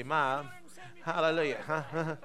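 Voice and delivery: ordinary delivery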